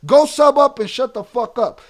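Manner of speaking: loud, fed-up shout down at a troll; angry yell. Real, not acted. Streamer yelling at a chat troll, loud and angry. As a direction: bark it at the commenter.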